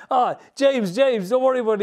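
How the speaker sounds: calm him down quickly; placating. Retold or imitated. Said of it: "And he was".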